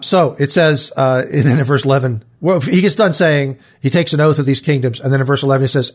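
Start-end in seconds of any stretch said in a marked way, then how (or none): none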